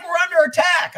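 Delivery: high, loud mock alarm; retold urgent shout; alarmed caller. Caller breaks alarming news to him.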